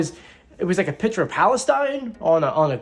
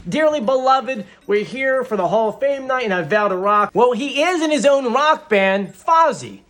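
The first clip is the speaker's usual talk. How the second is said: normal talking voice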